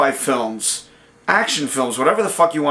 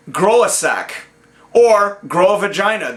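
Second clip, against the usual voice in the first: normal talking voice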